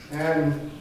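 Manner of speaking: ordinary talk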